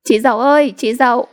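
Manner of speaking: high teasing yell; mock yell. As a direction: shout the taunt across the yard. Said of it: "Rồi chúng gào lên". (gào lên trêu chọc)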